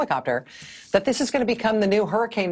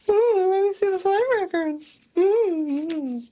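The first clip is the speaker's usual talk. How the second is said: high-pitched, taunting sing-song imitation; mocking sing-song; 11 semitones higher, slower than usual. Trump mocking a request for flight records, in sing-song. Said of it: "repeating the request in a sing-song voice".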